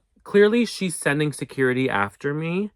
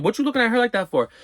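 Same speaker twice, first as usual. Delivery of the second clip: mock outraged challenge; 10 semitones higher, much faster than usual. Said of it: "I'm like".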